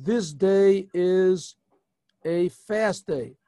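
the king's decree, drawn out and firm; slow royal proclamation. Acted. The king declares a fast to the city, slowly and gravely.

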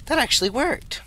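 high gasping surprise; gasp, then disbelief; high, breathless amazement; amazed gasp. Real, not acted.

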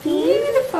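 sudden high delighted "beautiful"; excited praise. Real, not acted. Mum praising the child's craft.

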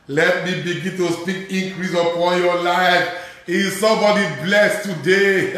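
loud, high hallelujah with laughter; joyful shout. Real, not acted. Preacher shouting praise over the congregation.

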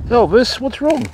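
high, worried coaxing to an emu; high fretful call to an injured emu; concerned pet talk. Real, not acted. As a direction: ask the hurt bird gently what's wrong.

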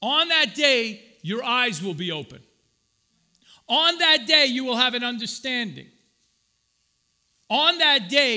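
loud, building repeated refrain; preaching build. Acted. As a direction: hammer the refrain each time.

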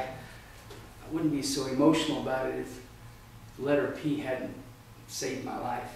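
ordinary delivery

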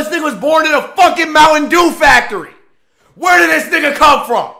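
ranting shout. Real, not acted. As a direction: blast it out in disbelief. Outraged anime rant.

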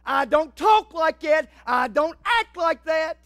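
loud, high, slow stern rebuke; God screaming. Acted. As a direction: shout the rebuke slowly and forcefully. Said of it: "God screams, says".

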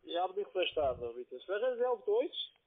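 ordinary delivery

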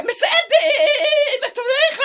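high cry of a name with a laugh in it; calling out. Acted. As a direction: shout for someone offstage. Calls out to someone offstage.

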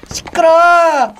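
high, loud irritated yell; annoyed shout. Real, not acted. Gamer yells at the wailing baby to hush.

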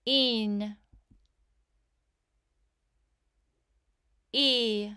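"E" said alone as a long, held ee; isolated "E", drawn out and clearly voiced; long E sound. Shown as a demonstration. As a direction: sound out each "E" as a pure letter sound. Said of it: "I will say the sound".

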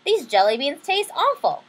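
a hammed-up grumble; complaining. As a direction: whine about the bunny's mess. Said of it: "I complained".

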